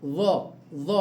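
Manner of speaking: ظ held alone, tongue tip between the teeth; teacher isolating ظ; isolated zha. Shown as a demonstration. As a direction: sound out the bare ظ. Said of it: "بيخرج من طرف اللسان".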